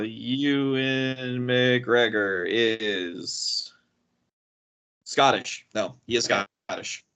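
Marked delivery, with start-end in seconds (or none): 0.0-5.7 very slow, stretched reveal; drawn-out suspense. Real, not acted. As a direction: drag it out before the reveal. Reads lookup result with suspense.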